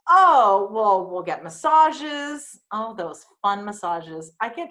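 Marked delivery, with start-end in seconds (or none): 0.1-2.6 slow, louder, oily invitation; sleazy slow promise. Retold or imitated. The yacht guy luring a teen with massages, slow and oily. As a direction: draw it out like a sleazy man. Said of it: "So he goes".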